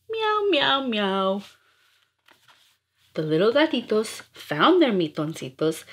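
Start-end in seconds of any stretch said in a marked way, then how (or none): 0.1-1.4 high mewing meows; kitten meows. Acted. Scolded kittens answering mother, high mewing meows. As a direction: mew like a sad little kitten.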